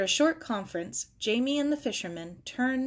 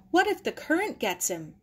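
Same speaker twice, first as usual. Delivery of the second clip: worried cry; 7 semitones higher than usual. A narrator's reading. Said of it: "Maggie cried".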